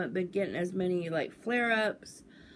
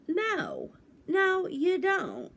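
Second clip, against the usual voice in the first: emphatic no; 12 semitones higher, much slower than usual. Real, not acted. Rhetorical answer to own question.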